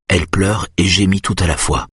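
ordinary delivery, nothing marked

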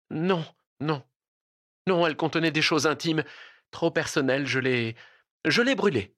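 lightly voiced flustered female cousin. A narrator's reading. Cousin lying to her relative about the letter, flustered.

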